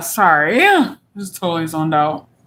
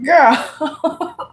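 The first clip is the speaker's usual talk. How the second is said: laughing; 7 semitones higher than usual. Real, not acted. Laughing fit.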